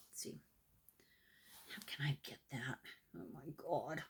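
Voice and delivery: low, whispery aside while fumbling; quick hushed self-talk; muttering to self. Real, not acted. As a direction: talk under your breath, barely audible. Fumbling with needles, to herself.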